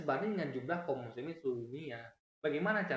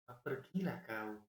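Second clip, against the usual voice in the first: Javanese accent, slow and softer, unhurried; 3 semitones lower, 4 dB quieter, slower than usual. Shown as a demonstration. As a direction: say it gently and slowly, the Javanese way. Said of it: "Orang Jawa". (logat Jawa, pelan dan lembut)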